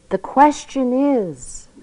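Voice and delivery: slow, weighty build to a pause; dramatic pause. A narrator's reading. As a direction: hold "The question is" slow, then pause. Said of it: "pausing dramatically".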